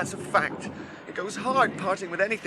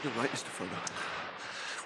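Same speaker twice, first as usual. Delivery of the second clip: hushed whisper; 6 semitones lower, 10 dB quieter, much slower than usual. Acted. Weary agreement on the cliff.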